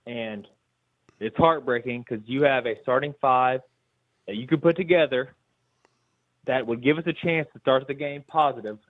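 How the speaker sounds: ordinary talk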